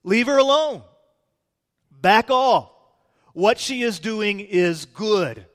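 intense rebuke. Acted. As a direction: act out a firm, heated order to stand down. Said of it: "there was some intensity".

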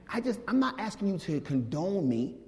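normal talking voice

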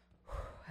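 re-enacted heavy sigh of relief; long forceful breath out; hard exhale. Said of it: "breathed out so hard".

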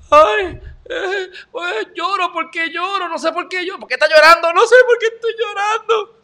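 high, hammed-up wailing sob; exaggerated pretend weeping, high and whiny; mock crying. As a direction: fake blubbering to mock crybabies. Mocks emotional people by fake crying.

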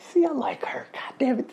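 high, whispery aside to yourself; hushed delight. Retold or imitated. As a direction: squeeze it out in a high, admiring whisper. Retells delighted aside to himself.

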